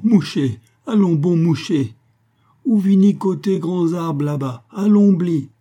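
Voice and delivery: put-on high, loud Creole old man coaxing anxiously; anxious urging. A narrator's reading. Old Casimir urges the sick man toward the shade, high anxious coaxing. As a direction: urge him to move, voice high and insistent. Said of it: "criait-il anxieusement".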